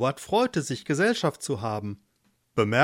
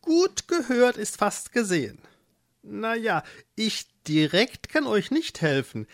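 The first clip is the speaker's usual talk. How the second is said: helpful mole; 4 semitones higher than usual. Acted. Mole proposes the magic-forest oasis, helpful character voice. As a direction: explain as a knowing little animal.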